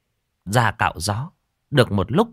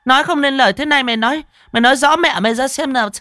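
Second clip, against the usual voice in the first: very high, loud screeching scolding; furious mistress, piercing and impatient; shrieking fury; 6 dB louder, faster than usual. A narrator's reading. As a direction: screech at the stammering servant. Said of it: "rồi miệng bà rít lên".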